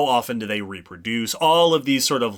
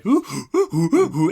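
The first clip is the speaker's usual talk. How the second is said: high, louder chimp hoots; chimpanzee hoot; 6 semitones higher, 4 dB louder than usual. Shown as a demonstration. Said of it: "doing that chimpanzee hoot".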